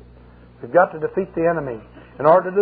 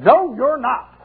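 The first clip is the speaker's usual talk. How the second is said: higher and louder scornful denial; demon's retort; 8 semitones higher, 5 dB louder, much slower than usual. Retold or imitated. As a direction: snap back as the demon. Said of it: "He said".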